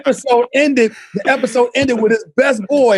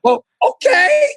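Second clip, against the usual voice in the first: high, slow reluctant give-in; a hammed-up shrug at being the Love Doctor; mock reluctant okay; 14 semitones higher, slower than usual. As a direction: shrug it off in a squeaky drawn-out okay.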